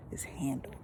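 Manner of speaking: dropped to a hushed whisper. Shown as a demonstration. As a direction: seal it with a whisper. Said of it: "I don't play about my word".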